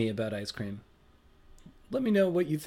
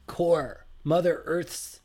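ordinary talk, nothing marked

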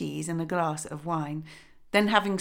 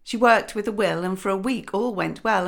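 ordinary delivery

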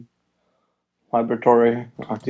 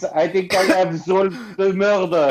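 put-on higher, smug French-accented detective; Poirot voice; 8 semitones higher than usual. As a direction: announce it like a proud Belgian sleuth. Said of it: "He thinks".

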